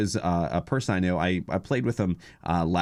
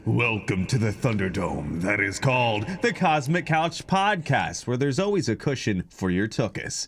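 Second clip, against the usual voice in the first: announcer intro; 2 semitones higher than usual. Acted. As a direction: present the hosts like a ring announcer. Hosts' grand podcast intro.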